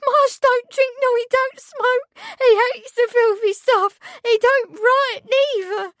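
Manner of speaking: put-on high, loud servant girl insisting; high-pitched loud insistent girl; indignant maid. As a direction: defend him hotly, voice high. Meg defends Marsh.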